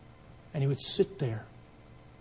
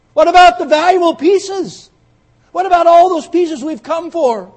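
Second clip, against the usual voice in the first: loud, much higher indignant cry; outraged crowd; 21 dB louder than usual. Acted. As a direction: protest in disbelief. Stunned crowd protests.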